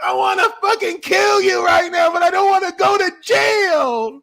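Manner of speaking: high, loud tearful rage; hammed-up furious wail through tears; mock angry crying. Host acting a mad Scorpio crying, hammed-up tearful shouting. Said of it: "Scorpio will cry when they mad".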